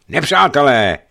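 loud, high warning cry; alarm shout. A narrator's reading. Sailor spots enemy ships and shouts the alarm. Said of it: "ozval se hlas Trufana".

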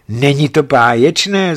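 vysoko a hlasitě, nadšeně; radostně zvolej do ticha sněhu; zvolání. A narrator's reading. (nadšené zvolání, vyšším a hlasitějším hlasem)

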